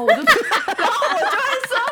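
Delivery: talking through laughter; laughing. Real, not acted. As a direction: laugh while speaking. Laughing while telling.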